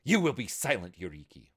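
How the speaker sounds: sharp commanding shout. A narrator's reading. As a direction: bark the order at full volume. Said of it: "Hijiko's shout seemed to make".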